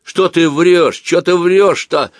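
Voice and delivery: high, loud indignant shout; закричал. A narrator's reading. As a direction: shout down the accuser, drawing it out. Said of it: "закричал притихший был Бакланов". (возмущённый громкий крик)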